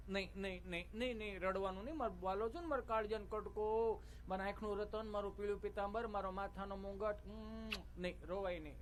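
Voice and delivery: soft, hushed pampering coo; cooing mother. Retold or imitated. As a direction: gently hush a crying baby. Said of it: "એમ કપડાં ખખેરે".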